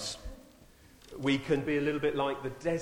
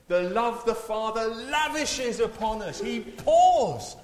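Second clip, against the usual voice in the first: much higher, louder, slowing into the climax; impassioned preaching; 11 semitones higher, 7 dB louder, slower than usual. Acted. Preacher proclaims God pours love on the church, impassioned. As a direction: swell it up like a great proclamation.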